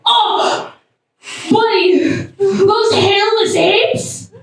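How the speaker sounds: high-pitched, drawn-out buddy talk; excited dolphin character. Acted. Pleased dolphin telling a buddy about humans, excited. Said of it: "so that guy's just like".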